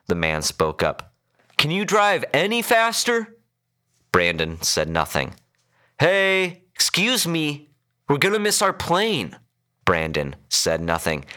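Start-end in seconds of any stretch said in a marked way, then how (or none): 1.6-3.4 high-pitched, impatient demand; frustrated demand. A narrator's reading. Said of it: "much to the man's frustration". 6.0-9.4 high, insistent complaint; impatient calling out. A narrator's reading. Ignored passenger urging the driver.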